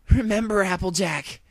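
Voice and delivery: high, very loud scream. Acted. Dash to brainwashed friend, screaming. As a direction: scream her name to reach her. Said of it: "Dash screamed".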